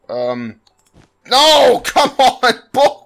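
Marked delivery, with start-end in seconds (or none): 1.3-3.0 loud, higher cry of protest with a laugh; frustrated shout. Real, not acted. Player shouting at the failing game, laughing. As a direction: yell at the game, half laughing. Fails the level again.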